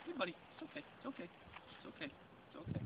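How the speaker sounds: calm a terrified dog very gently; soothing reassurance. Real, not acted.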